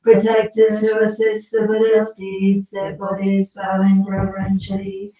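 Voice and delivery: steady, sonorous liturgical reading; scripture recitation. Acted. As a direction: read the sacred text with devotion. Reads the Manjushri scripture aloud.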